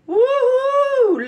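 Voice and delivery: high, loud joyful woohoo; excited whoop. Real, not acted. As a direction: cheer it out like you nailed it. Cheering over finished taco.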